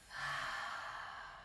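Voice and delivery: soft, airy breath out through the mouth; relaxed audible exhale; long sighing exhale. Shown as a demonstration. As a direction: let a deep breath go slowly.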